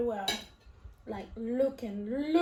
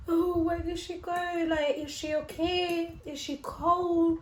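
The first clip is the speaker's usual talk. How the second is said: higher-pitched anxious fretting; retold worrying; 5 semitones higher than usual. Owner imagining their lost ferret out there, plaintive worry. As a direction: fret aloud in a raised, plaintive voice. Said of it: "I was thinking".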